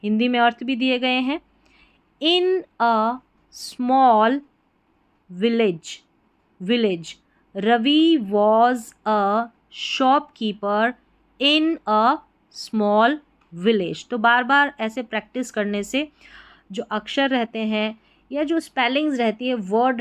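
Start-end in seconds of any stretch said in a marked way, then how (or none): 2.2-14.1 word by word, very slow; very slow reading. Shown as a demonstration. Teacher reading the story aloud for students to follow, very slow. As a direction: read each word apart, very slowly. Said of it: "बिल्कुल शुरुआत से यहाँ पर आप पढ़ना सीखेंगे".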